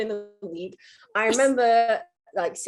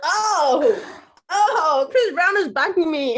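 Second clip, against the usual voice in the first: much higher and louder, delighted; retold thrill; 7 semitones higher, 6 dB louder, slower than usual. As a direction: gush like a fan who just got backed up.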